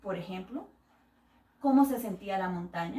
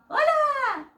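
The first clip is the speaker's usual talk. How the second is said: much higher, excited little bird voice; blue bird; 7 semitones higher, 3 dB louder than usual. Acted. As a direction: greet your old friend as a happy bird. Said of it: "su amigo el pájaro azul".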